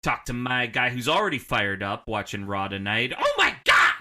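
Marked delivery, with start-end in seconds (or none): 3.2-3.9 high, loud hyped-up yell; excited shout. Pretend. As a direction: shout it like a wrestling announcer. Host hyping up the next guest.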